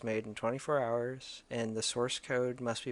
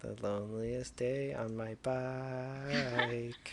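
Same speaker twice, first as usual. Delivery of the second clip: mock lament; 4 dB quieter, much slower than usual.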